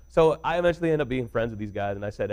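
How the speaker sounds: usual speaking voice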